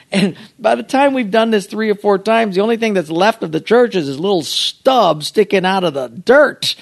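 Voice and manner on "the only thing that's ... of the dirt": ordinary delivery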